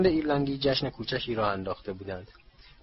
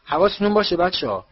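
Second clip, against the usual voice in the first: raised, higher warning shout; 7 semitones higher, 8 dB louder than usual. A narrator's reading. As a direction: call out over a noisy crowd. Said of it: "فریاد زد".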